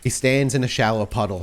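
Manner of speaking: normal talking voice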